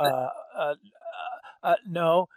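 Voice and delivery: put-on a scared fourteen-year-old stalling; mock-timid kid stammering; hesitant teenager. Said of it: "I go".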